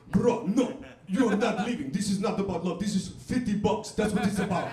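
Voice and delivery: put-on firm Arabic accent, demanding fifty bucks. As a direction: insist like a stubborn Arabic clerk. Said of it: "all my accents that I do in comedy are Arabic people".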